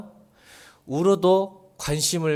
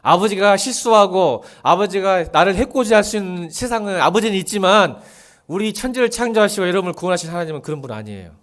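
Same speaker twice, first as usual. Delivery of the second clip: fervent preaching; 3 semitones higher, 6 dB louder than usual. Acted. Pastor pleading with the congregation, full-force preaching. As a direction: preach it out with rising fervor.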